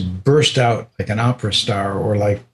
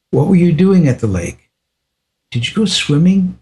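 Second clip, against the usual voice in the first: teasing guru; 6 semitones higher than usual. Retold or imitated. Guru pressing the visitor about the lake, higher and teasing. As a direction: needle him with the question. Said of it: "he started teasing me".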